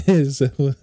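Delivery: bursts out laughing mid-question. Real, not acted. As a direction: laugh right through the words, caught off guard. Laughing at "we're old" joke.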